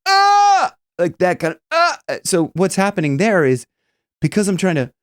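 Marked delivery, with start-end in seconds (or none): 0.1-0.7 high, loud thick "ah"; short high yelled vowel; thickened yell. Shown as a demonstration. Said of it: "a thickened kind of yell". 1.7-2.0 short, thick yelled "ah"; quick burst of thickened yelling. Shown as a demonstration. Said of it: "a thickened kind of yell".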